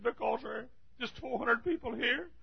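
high, soft mock-tearful voice; retold tearful explanation; imitating tearful pastor. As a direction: plead softly like an upset pastor. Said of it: "I mean, crying tears".

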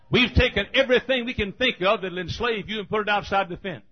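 loud, high, emphatic pulpit voice; preacher in full cry; fiery preaching. Acted. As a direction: drive it home to the congregation.

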